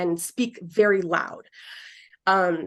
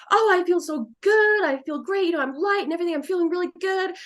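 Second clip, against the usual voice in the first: much higher, light and cheerful; happy spirit voice; 9 semitones higher than usual. Retold or imitated. Spirit telling the medium he feels great, much higher and cheerful. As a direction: voice a spirit floating free. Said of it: "He's like".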